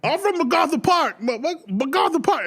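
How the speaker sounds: high, laughing hometown shout; mock gang shout-out. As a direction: shout your neighborhood proudly. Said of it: "someone starts saying".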